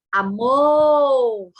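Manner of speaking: "amor" called out, MOR loud and stretched long; stressed syllable drawn out. Shown as a demonstration. Teacher showing the tonic syllable to sixth graders, "amor" called out with MOR stretched. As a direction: call "amor" as if shouting for someone, dragging MOR. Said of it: "gritar essa sílaba no seu pensamento, como se você estivesse chamando alguém".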